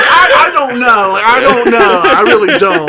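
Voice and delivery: flustered denial. Retold or imitated. Telling partner he doesn't know, mock flustered. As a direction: imitate scrambling to plead ignorance.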